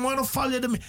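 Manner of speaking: high-pitched scolding quote; mock rebuke. People rebuke the shouting blind man, scolding. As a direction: imitate an annoyed crowd, pitched high. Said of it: "mensen bestraften hem".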